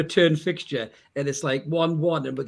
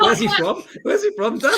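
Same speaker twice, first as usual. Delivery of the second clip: high, loud, helpless laughter through the words; laughing; 6 dB louder than usual. Real, not acted. Host teasing Dan over his bad accent, laughing. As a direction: crack up while asking.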